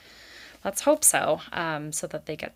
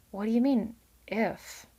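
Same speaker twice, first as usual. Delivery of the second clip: tremulously; 4 semitones higher than usual. A narrator's reading. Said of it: "Clover asked tremulously".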